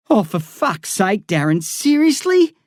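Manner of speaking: incredulous, pitch flying up; disbelieving groan at a friend; exasperated. A narrator's reading. Narrator exasperated by blindfold.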